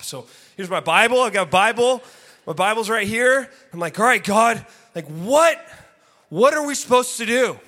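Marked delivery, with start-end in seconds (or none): 4.0-7.6 loud, high appeal upward; demanding plea. Retold or imitated. Man alone in bedroom asking God for direction, loudly demanding. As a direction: press God hard for an answer.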